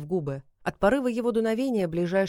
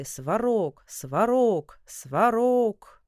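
shouting; 4 semitones higher, 4 dB louder, much slower than usual. Acted. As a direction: chant building louder each time. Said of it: "и все кричали".

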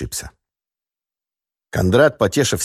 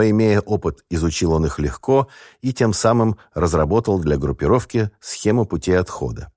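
plain reading voice

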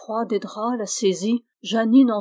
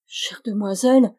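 usual speaking voice

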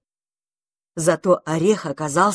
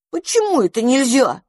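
surprised; 7 semitones higher, 3 dB louder than usual. A narrator's reading. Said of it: "удивились бельчата".

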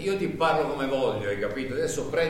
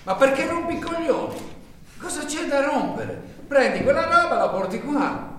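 much higher, louder furious yell; angry shout; 11 semitones higher, 4 dB louder than usual. Acted. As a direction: blow up at him. Boss loses temper in quarrel.

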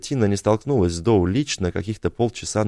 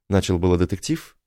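ordinary delivery

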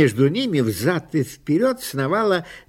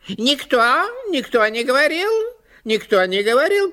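high, fast nervous magpie chatter; 9 semitones higher than usual. A narrator's reading. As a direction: chatter it fast, dodging blame. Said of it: "протрещала сорока".

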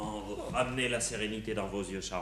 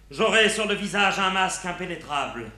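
hammy stage actor; 5 semitones higher, 6 dB louder than usual. Acted. Declaiming stage verse.

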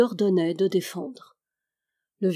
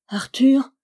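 breathless whispered name, pitched up; whispered call; 5 semitones higher, 6 dB louder than usual. Acted. Marie calls her lover beneath the tower, whispering. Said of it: "elle chantait parmi ses larmes".

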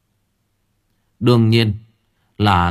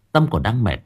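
ordinary delivery

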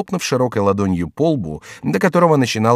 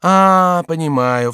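high, loud "ah" sliding down; sudden high exclamation of understanding; exclaimed realisation; 8 semitones higher, 5 dB louder, slower than usual. A narrator's reading. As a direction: cry out as the penny drops. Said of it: "вскричал Шико".

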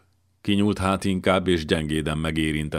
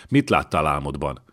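ordinary delivery

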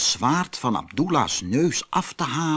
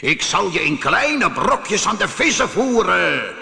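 raised, menacing genie voice; calling out as genie; 6 semitones higher, 3 dB louder than usual. Acted. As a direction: hurl the threat down at the fisherman. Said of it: "riep de geest". (dreigend roepende geest)

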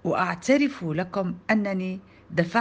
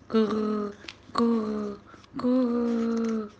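drawn-out level coo; baby cooing; 2 semitones higher, much slower than usual. A narrator's reading. Baby brother gurgling at play, cooing. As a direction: coo like a baby in steady notes.

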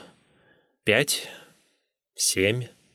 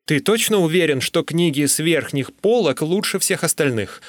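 higher, insistent questioning; pitched-up, stubborn challenge; persistent arguing; 6 semitones higher than usual. A narrator's reading. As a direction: keep pressing the point. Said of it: "гнул я своё".

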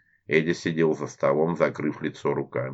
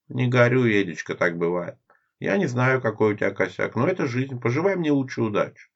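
higher, teasing irony; ironic; 7 semitones higher than usual. Acted. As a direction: needle him with mock sympathy. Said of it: "с иронией в голосе Лера".

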